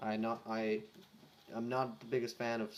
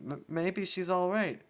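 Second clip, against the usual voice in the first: nervous hope; 8 semitones higher, 4 dB louder than usual. Acted. Sweetie nervous.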